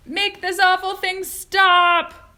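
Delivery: high, loud, slow demand; ordered. A narrator's reading. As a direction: bellow an order in panic. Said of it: "ordered Mister T V".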